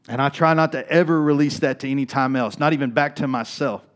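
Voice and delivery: loud, high, driving sermon voice; preaching at full force. Acted. Pastor urging the congregation, preaching at full force. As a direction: build it up like a preacher at the peak.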